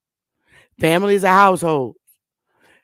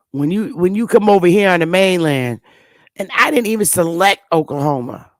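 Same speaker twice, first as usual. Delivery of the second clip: usual speaking voice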